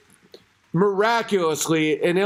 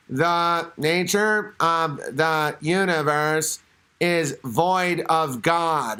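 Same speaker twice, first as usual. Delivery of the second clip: slow, lower robotic machine voice; Stephen Hawking; 4 semitones lower, much slower than usual. Retold or imitated. Host imitating the wheelchair voice machine, slow robot drone. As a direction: drone it like a speech synthesizer. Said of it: "And it was like".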